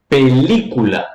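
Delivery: PELÍCULA with a long, leaned-on LI; stress on LI. Shown as a demonstration. Teacher finding the stressed syllable, PELÍCULA with LI held long. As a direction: draw out the second syllable of PELÍCULA. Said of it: "Qual é a sílaba tônica?".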